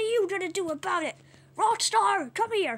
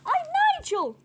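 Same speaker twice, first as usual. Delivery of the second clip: very high cartoon bird voice; high squawky correction; 11 semitones higher, 4 dB louder than usual. Bird sets identity straight in a high squawk. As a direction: squeak the name out high.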